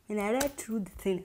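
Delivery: ordinary talk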